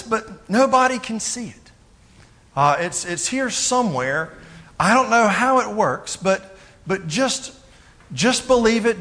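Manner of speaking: believing fish. Acted. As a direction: play a fish urging a friend to just believe. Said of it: "the other fish would say".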